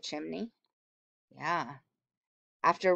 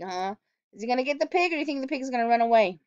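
plain reading voice

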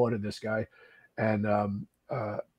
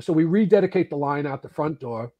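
usual speaking voice

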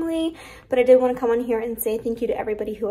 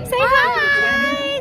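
usual speaking voice

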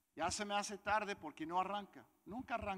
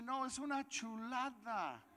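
higher, slow, savoring praise; imitated delighted buyer; 7 semitones higher, slower than usual. New owner praising the motorbike, imitated delight. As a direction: draw out the praise, pitched up.

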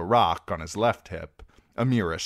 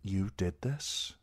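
queasy; 6 dB quieter, slower than usual. A narrator's reading. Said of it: "Dany asked queasily".

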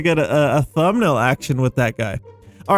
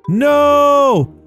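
loud, high drawn-out cry of dismay; real gamer's howl of frustration; dismayed shout; 10 semitones higher, 5 dB louder than usual. Real, not acted. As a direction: wail it out as it all goes wrong. Loses morph in the game.

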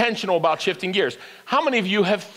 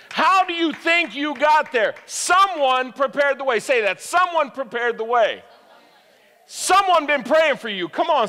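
preaching at full force; 6 semitones higher than usual. Acted. Pastor rallying the congregation, fired-up preaching. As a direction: rouse the congregation.

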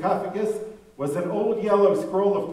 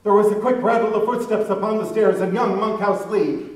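usual speaking voice

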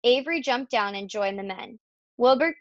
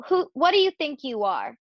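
indignant mother's question, pitch raised; storybook telling-off; scolding; 5 semitones higher, 3 dB louder than usual. A narrator's reading. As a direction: demand it crossly of a child.

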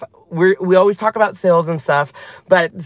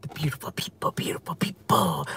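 whisper; 3 semitones lower, 11 dB quieter than usual. Pretend. Echoing own greeting in whisper.

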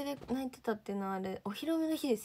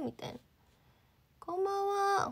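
ordinary talk

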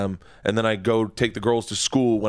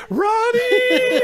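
high squealing yell through a laugh; Fez crying out Ronnie; 6 dB louder than usual. Retold or imitated. Retelling Fez's radio breakdown to the hosts, high laughing shriek. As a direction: shriek the name as if sobbing on air. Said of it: "And he's like".

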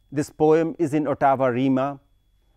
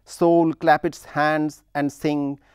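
head word Soul hit hard; stress on Soul; slower than usual. Shown as a demonstration. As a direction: give Soul its own beat. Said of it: "the soul gains emphasis".

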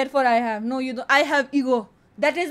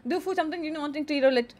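ordinary delivery

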